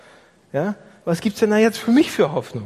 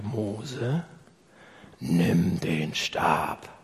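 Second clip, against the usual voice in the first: lower, very slow solemn command; voice of God; 11 semitones lower, 5 dB quieter, much slower than usual. Retold or imitated. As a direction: speak as God, grave and deliberate. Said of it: "er spricht dann zu Mose". (Gott spricht zu Mose, tief und langsam)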